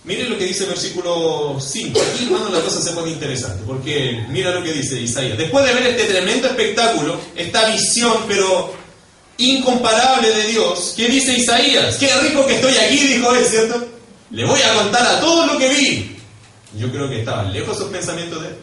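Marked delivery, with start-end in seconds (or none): 5.4-16.2 loud, high, slowing for emphasis; full-force pulpit delivery; impassioned preaching. Real, not acted. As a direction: proclaim it with mounting mock enthusiasm. Preacher builds to ironic misquote.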